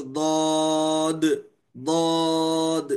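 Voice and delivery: ضاد drawn out slowly, sound released gradually; elongated dad. Shown as a demonstration. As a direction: let ضاد stretch out bit by bit. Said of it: "بتدریج نکلتی ہے". (ضاد کو بتدریج کھینچ کر)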